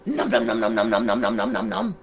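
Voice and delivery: rapid low-pitched nom-nom gobbling; monster munching noises. A narrator's reading. Monster gobbling food from townspeople, low munching noises. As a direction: gobble in a run of low syllables.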